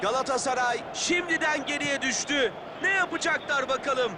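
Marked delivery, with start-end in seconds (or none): none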